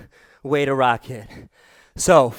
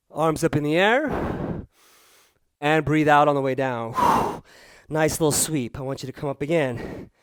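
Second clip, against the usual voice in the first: calm cool-down guide. Acted. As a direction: ease them down gently. Leads the cool-down breathing.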